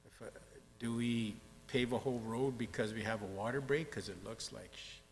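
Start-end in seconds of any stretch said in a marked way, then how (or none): none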